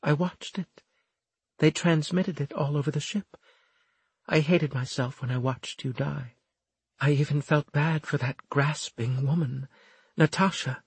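high, hushed woman's character voice; distraught Florimel, remorseful. A narrator's reading. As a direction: recall it painfully in a high, husky voice. Voices Florimel recalling the death.

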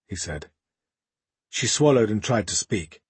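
ordinary delivery, nothing marked

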